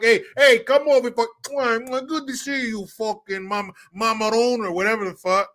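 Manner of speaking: hammed-up Italian-American welcome to the son-in-law; mock Italian-American mafia greeting; Godfather mobster greeting. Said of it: "some character from The Godfather's".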